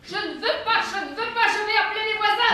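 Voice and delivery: mother screaming in fear at her son, frantic; panicked scream. Acted. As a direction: shriek it in terror as he closes in. Mother screams, refuses him.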